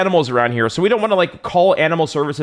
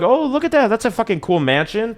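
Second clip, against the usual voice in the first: lightly acted higher-pitched marvelling; mock distracted awe; 7 semitones higher than usual. Imitated doctor glued to the TV, mock distracted awe. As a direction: imitate someone suddenly distracted and impressed.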